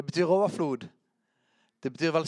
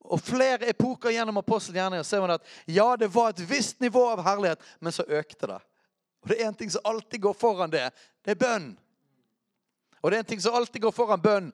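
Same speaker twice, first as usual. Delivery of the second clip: higher, emphatic sermon delivery; fervent preaching; 7 semitones higher, 5 dB louder than usual. Real, not acted. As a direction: drive the teaching home with rising fervour. Preaching at raised intensity.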